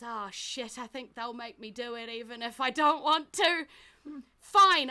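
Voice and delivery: loud, exasperated shout; frustrated outburst. Acted. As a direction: blurt it out, fed up and angry. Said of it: "looks at you all and goes".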